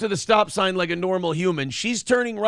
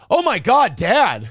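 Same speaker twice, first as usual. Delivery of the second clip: imitating a scandalised daughter, high and loud; shocked; 5 semitones higher, 4 dB louder, slower than usual. Daughter from the back seat catching dad out, shocked. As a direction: gasp it out as the horrified kid. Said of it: "it was that level of surprise".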